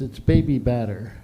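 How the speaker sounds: usual speaking voice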